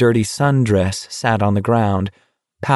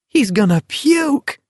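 high, drawn-out gleeful cry; delighted cry. A narrator's reading. Spectator in the crowd watching Garrity stagger, gleeful cry. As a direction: crow it high, in an ecstasy of delight. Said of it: "someone cried in an ecstasy of delight".

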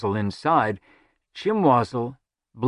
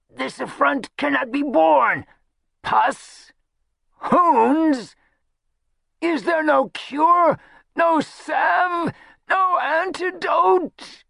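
very high, wailing indignation; appalled outcry; much slower than usual. A narrator's reading. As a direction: wail desperately for a remedy. Chimwazzle appalled, begging for a cure.

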